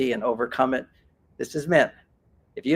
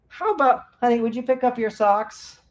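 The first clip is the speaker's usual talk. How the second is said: sweet request; 7 semitones higher than usual. Shown as a demonstration. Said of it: "Complaint is just an uneducated version of asking".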